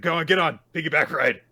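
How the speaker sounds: high, loud, eager urging; pumped-up mock offer; eager call. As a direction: bounce with readiness, come on. Eager boy offers piggyback.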